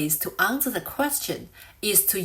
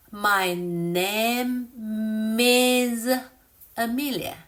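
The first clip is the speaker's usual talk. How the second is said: "My name is Amelia" in slow pieces, "name" and "is" held long; said slowly; slower than usual. Shown as a demonstration. Teacher showing her lips, slow linked "My name is Amelia". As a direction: draw out "name" and "is" before "Amelia". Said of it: "I'll say it slowly again".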